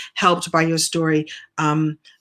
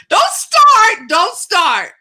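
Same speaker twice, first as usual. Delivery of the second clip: much higher, louder playful yell; 13 semitones higher, 7 dB louder, much slower than usual. Real, not acted. Host yells at someone off-camera to stop, yelling. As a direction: yell it to cut them off. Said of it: "I'm yelling at you".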